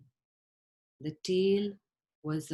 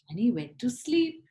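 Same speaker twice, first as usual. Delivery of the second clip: plain reading voice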